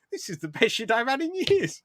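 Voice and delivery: high-pitched, hammed-up delivery; mock-delighted squeal of a line; put-on Carl line. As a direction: play the bit part in a comically high voice. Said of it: "You could just be like".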